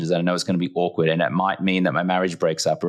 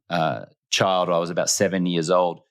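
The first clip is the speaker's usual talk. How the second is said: usual speaking voice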